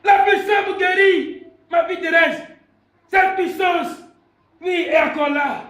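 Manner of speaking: loud, high-pitched sermon cry; full-force preaching. Real, not acted. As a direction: proclaim it to the whole church. (prédication enflammée, criée)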